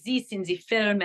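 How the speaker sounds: light French accent, th turned to z; a quick taste of her French accent. Shown as a demonstration. Showing the interviewer her old film role, light French accent. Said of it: "that is where I first tried my French dialect".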